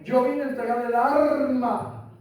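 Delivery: normal talking voice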